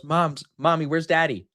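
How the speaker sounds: lightly voiced kid needling mom; teasing three-year-old. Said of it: "he's rage baiting her at three years old".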